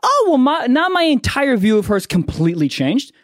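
a hint of a high, impressed sister. The sister learning the girlfriend is Australian, impressed. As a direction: sound suddenly won over. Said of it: "my sister was like".

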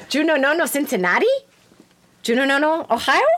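high, loud imitation of a comic foreign accent; Misha Morell accent. Host trying to perfect her classmate's accent, high mimicry. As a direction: copy the silly accent, pitched high and rising. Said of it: "trying to perfect the Misha Morell accent".

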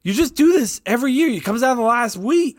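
high mock exasperated wife; imitating fed-up wife. Retelling his wife's reply, high mock exasperation. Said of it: "she's like".

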